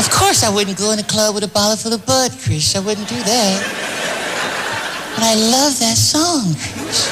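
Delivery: [name] impression. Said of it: "Michael voice continues".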